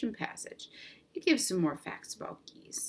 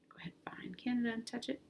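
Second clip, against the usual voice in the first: whisper; 4 semitones higher, 7 dB quieter, faster than usual. Real, not acted. Urging kids to touch map.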